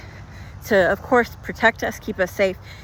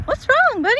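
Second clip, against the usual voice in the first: high, sing-song coaxing voice; tender high baby talk to cheer him up; baby talk to boyfriend; 3 dB louder than usual. Retold or imitated. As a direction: coo at him like a sad child. Coaxing her sad boyfriend.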